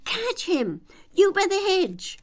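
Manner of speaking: high, loud urgent yell; rabbit shouting. A narrator's reading. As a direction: shout orders across the garden. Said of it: "Then the rabbit's voice alone".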